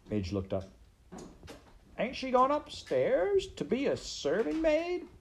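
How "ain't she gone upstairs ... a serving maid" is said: very high, squeaky maid voice; childlike serving girl. Acted. Maid questioning the rat about the princess, high and dismayed.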